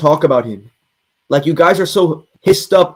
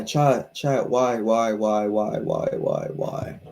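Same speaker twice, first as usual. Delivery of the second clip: fading mock despair; 7 semitones lower, 10 dB quieter, slower than usual. Despairing whys fading away.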